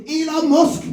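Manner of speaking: put-on high-pitched excited Scouse child; Scouse kid. Kid answering the host's question, high Scouse voice. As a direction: pipe up like a little Scouse kid. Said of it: "this Scouse kid goes".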